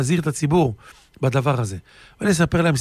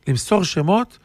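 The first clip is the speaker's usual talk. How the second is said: ordinary talk, nothing marked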